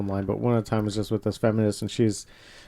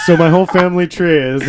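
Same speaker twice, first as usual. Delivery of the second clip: 笑着模仿; laughing quote; 4 semitones higher, 10 dB louder than usual. Retold or imitated. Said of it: "And fucking say".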